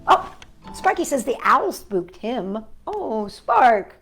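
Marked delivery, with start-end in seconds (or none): none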